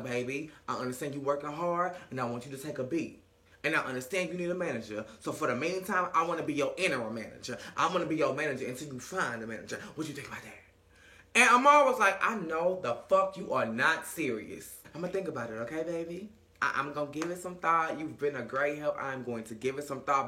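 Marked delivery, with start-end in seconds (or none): 0.0-10.6 much lower, softer coaxing voice; butter her up low and smooth; smooth-talking man. Retold or imitated. 12.2-20.3 much lower, softer, fake-polite; low polite brush-off. Amara politely brushing him off.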